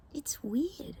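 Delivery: hushed whisper outdoors. Real, not acted. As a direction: whisper it so the birds aren't scared off. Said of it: "so we should be quiet".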